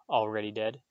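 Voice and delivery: ordinary delivery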